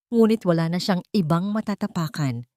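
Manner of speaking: ordinary talk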